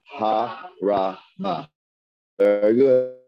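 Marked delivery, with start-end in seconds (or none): 0.1-1.7 Ha-ra-ma split into slow separate syllables. Shown as a demonstration. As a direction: sound out ha, ra, ma slowly. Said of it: "One more time".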